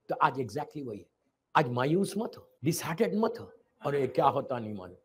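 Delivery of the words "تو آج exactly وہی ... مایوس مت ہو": usual speaking voice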